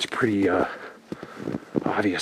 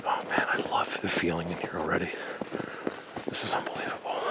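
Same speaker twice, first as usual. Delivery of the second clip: whispering; 4 semitones lower, 8 dB quieter than usual. Real, not acted. Hushed awe in forest.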